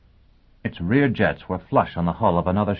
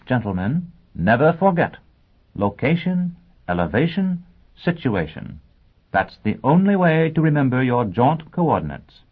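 bright, fluttery lady teacher, much higher; high schoolteacher voice; 7 semitones higher than usual. Acted. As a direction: play the scatterbrained teacher addressing her class. Teacher Robin voiced in story.

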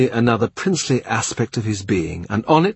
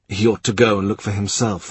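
ordinary delivery, nothing marked

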